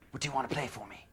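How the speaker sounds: [name]. Retold or imitated. Singer on floor asking guitarist, soft impersonation. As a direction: ask gently in a put-on voice. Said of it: "He looks up and he goes".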